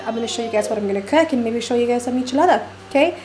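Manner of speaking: rushed, rapid-fire vlog chatter; hurried and quick; talking really fast. Real, not acted. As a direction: rattle it off, in a hurry to leave. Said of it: "I'm talking really fast".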